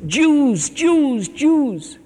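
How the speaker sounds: high, loud wailing cries, each falling; anguished shouts. Retold or imitated. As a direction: cry it out three times like torture. Hitler wailing in hell.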